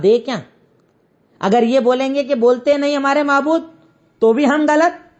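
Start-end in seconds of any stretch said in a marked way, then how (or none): none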